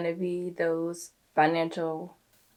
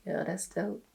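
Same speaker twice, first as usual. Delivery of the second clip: monotone voice; 10 dB quieter than usual. Retold or imitated. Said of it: "replies in a monotone voice".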